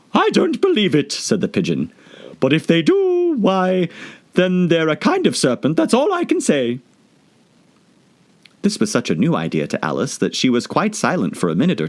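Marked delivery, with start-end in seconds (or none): 0.1-6.8 very high stubborn bird; stubborn pigeon. Acted. Pigeon arguing with Alice, stubborn. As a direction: refuse to believe.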